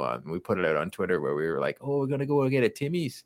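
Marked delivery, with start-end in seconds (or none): 1.8-3.2 higher-pitched joke Canadian; Canadian accent. Acted. Joke video about a coffee run, mock Canadian accent. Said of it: "pretty much the typical Canadian accent".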